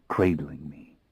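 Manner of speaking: ordinary delivery, nothing marked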